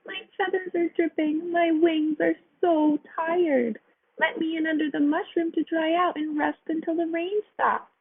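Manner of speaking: a touch of weepy pleading; crying sparrow. A narrator's reading.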